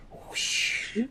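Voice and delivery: breathy whooshing throw noise; whoosh sound. Retold or imitated. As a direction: blow out a quick whoosh. Mimics throwing rope.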